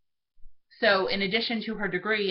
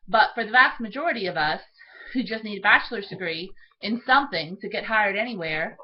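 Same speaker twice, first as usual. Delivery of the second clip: normal talking voice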